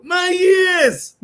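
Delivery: very high shouted "My yes"; Southern accent. Retold or imitated. Insisting this is how his friend talks, very high. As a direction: screech it in an overdone Southern accent. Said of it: "the accent ever gets that deep".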